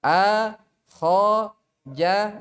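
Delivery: two-harakat "a, kho, ja" example; lengthened letters. Shown as a demonstration. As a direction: stretch each vowel of "a, kho, ja". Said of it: "kalau kayak tadi".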